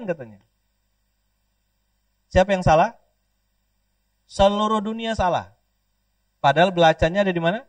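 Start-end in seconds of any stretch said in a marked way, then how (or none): none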